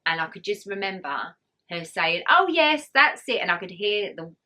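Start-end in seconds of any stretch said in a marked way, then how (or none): 2.3-3.4 mock midwife cheer, higher and louder; high, loud encouragement like a cheering midwife. Midwife voiced encouraging during birth.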